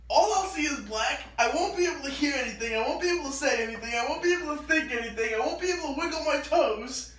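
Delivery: put-on fast, high, tumbling list of child fears; rushed anxious list. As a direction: rattle it off like a scared kid. Retold child listing fears.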